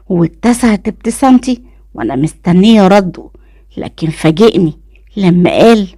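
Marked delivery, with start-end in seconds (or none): none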